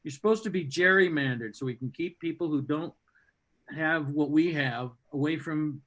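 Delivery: ordinary talk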